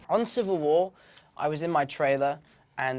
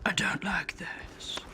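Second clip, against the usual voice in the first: slow, softer whisper of discomfort; whispered Batman gripe; 2 semitones higher, 8 dB quieter, much slower than usual. Pretend. Reacting to the interviewer's teasing, whispered Batman gripe. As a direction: breathe it out like a sulky vigilante.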